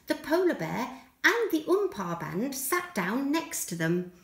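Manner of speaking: ordinary delivery, nothing marked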